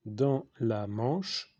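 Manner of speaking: very slow, drawn-out words with a long break; slow dictation. Real, not acted. Teacher repeating a phrase for writing, very slow dictation. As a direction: stretch each word for pupils writing it down.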